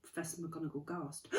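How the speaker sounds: usual speaking voice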